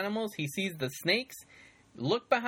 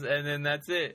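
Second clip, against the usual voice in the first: normal talking voice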